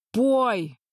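loud roaring command. A narrator's reading. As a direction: roar it out fiercely. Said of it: "проревел". (громкий рёв)

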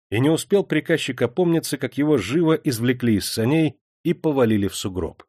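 usual speaking voice

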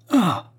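high, loud "ah" of sudden hope; sharp burst of relief; hopeful cry. A narrator's reading. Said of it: "avec une explosion d'espoir".

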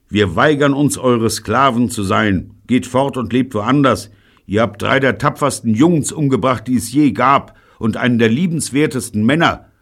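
loud, high, proclaimed address; declamatory speech. Acted. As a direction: make a bold proclamation to the enemy. Formal speech to the enemy.

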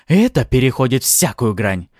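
loud, outraged exclamation. A narrator's reading. Artara rebuking the attackers, outraged. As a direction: protest angrily at full voice.

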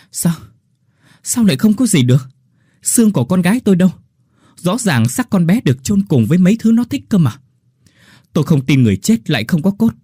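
high, loud, fast disbelieving questions; father can't believe his daughter's bones are gone; shocked demand. Acted. Father demands answers at the grave, loud and shocked. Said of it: "cố lấy lại bình tĩnh hỏi".

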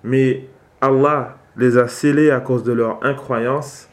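normal talking voice

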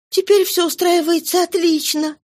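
put-on high young girlish voice, hurt and scolding; a daughter chiding her father for leaving; Cosette's reproach. A narrator's reading. Cosette scolds her returned father, high, reproachful.

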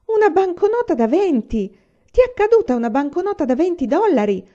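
high and loud, bursting with news; excited loud cry over the banknote; excited shriek. A narrator's reading. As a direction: shout the discovery in disbelief. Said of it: "strillò Laura".

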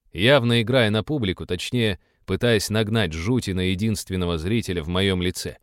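ordinary delivery

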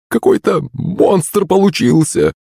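high, slow woman's lament; dismayed exclamation. A narrator's reading. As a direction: wail in horror at the result. Pyshka appalled by ruined bush. (огорчённое восклицание)

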